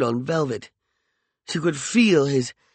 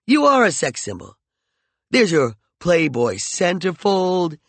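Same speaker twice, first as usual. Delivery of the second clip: higher, louder cutting taunts; angry taunting; 6 semitones higher, 4 dB louder than usual. Acted. McCoy taunts Peregrine in their quarrel, louder and higher. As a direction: wound her with every word.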